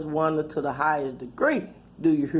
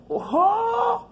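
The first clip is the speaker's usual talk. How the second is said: shocked huh. Real, not acted. Hears her name called out.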